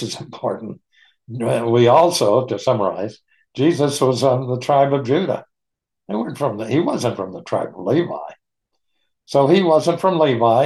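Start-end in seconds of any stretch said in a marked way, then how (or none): none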